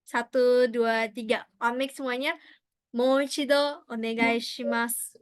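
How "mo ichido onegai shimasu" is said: "Mo ichido onegai shimasu" said slowly, each syllable clear; slow model phrase. Shown as a demonstration. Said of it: "kita pronounce lagi ya".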